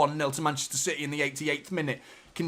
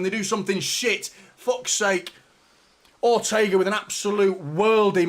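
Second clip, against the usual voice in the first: normal talking voice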